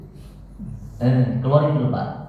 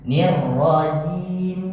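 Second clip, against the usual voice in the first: "nir" stretched long and slow, higher; drawn-out nir; 4 semitones higher, much slower than usual. Shown as a demonstration. Teacher showing the student a long "nir". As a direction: draw out "nir rajim" very slowly. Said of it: "teacher models the drawn-out nir".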